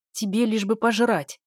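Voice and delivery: ordinary delivery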